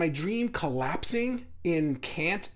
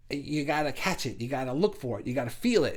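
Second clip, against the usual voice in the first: normal talking voice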